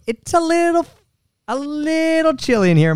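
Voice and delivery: squeaky high-pitched whine about the cold; high mock complaint. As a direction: play it up like you're freezing.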